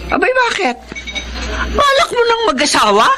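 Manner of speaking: surprised. Acted. Mother startled by marriage talk.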